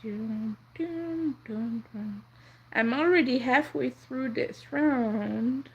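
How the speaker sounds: usual speaking voice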